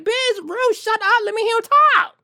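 very high, exasperated squawk; hammed-up fed-up yelling; exasperated outburst. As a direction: build to a loud, high-pitched demand. Acted exasperated outburst at an interrupter.

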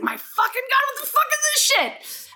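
high-pitched disbelief at something terrifying; shocked outburst. Retold or imitated. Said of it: "I was like".

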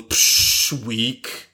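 breathy scoff, then a flat, unimpressed weak; dismissive scoff. Retold or imitated. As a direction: brush off the pain like a tough kid. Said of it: "the eleven-year-old goes".

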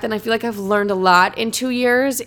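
ordinary talk, nothing marked

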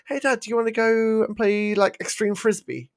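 put-on high-pitched kid asking Dad to play; imitating eager son. Son asks his unathletic dad to play frisbee, eager imitated plea. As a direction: pitch it up like a keen child. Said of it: "My son's like, randomly".

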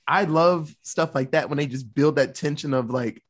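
ordinary talk, nothing marked

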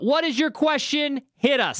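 a high, excited shout to the listener; calling out. Real, not acted. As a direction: call for the question like a game show host.

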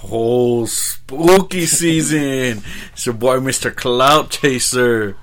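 slow, drawn-out spooky voice; spooky announcer. Acted. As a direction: stretch it out like a haunted host.

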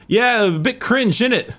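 high, slow imitation of a British accent; imitating British accent. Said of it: "He's like".

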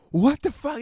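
sudden high, loud "what the fuck"; outraged. Retold or imitated. Retelling being shorted by a dealer, outraged. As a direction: blurt it out in disbelief.

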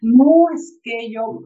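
slow, effortful, halting speech; struggling to speak. Retold or imitated. Man recovering from hospital struggling to get words out, slow and effortful. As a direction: push each word out slowly, as if speaking is still hard. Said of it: "a como él podía".

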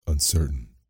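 usual speaking voice

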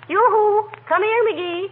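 sing-song yoo-hoo call. Acted. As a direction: call him over across the shop. Calling husband from across store.

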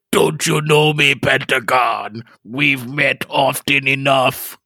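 slow, menacing unseen figure; mysterious voice. Acted. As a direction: taunt him slowly from the light. Mystery figure speaks, story voice.

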